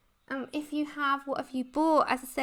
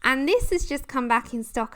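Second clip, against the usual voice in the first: normal talking voice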